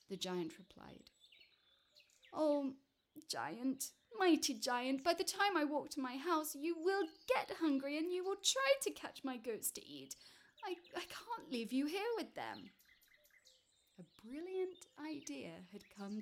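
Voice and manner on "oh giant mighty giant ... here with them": high-pitched, anxious appeal; pleading little man. Acted. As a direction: plead nervously with a mighty giant.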